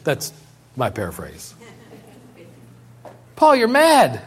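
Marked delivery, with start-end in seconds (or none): 3.4-4.2 high, loud scoffing cry; loud exclamation. Acted. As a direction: fling it at him like an accusation. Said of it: "said in a loud voice".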